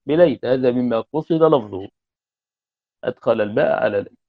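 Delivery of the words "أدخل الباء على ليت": normal talking voice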